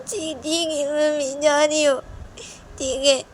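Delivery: high, slow, tearful agreement; tearful child. Acted. Liya tearfully agreeing to do the sit-ups. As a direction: give in through tears.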